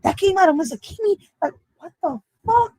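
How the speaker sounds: high, soft incredulous questions; high-pitched stunned asking; mock disbelief. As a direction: sound floored by what you heard. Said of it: "And I'll be like".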